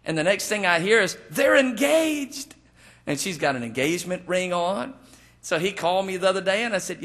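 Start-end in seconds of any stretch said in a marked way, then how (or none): 1.3-2.5 high, drawn-out announcement of startling news; slow, raised-pitch mock astonishment; astonished retelling. Preacher relaying news of a whirlwind engagement, astonished.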